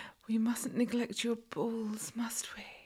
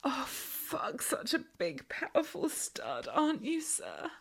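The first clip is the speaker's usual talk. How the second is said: ordinary delivery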